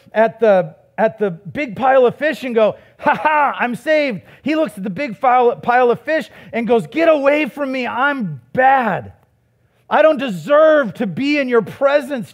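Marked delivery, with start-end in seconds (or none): 3.0-4.2 high, hammed-up gloating; mock triumph. Preacher voices Peter's imagined glee at the fish, mock triumph. 6.9-12.3 high, forceful plea of unworthiness; acted self-abasement. Preacher voices Peter recoiling from Jesus, acted self-abasement. Acts out Peter's horror at himself.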